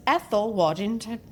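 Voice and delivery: the name in a light put-on British accent; jokey British accent; mock British accent. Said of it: "so you have to say it".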